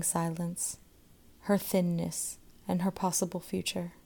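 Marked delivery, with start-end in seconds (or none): none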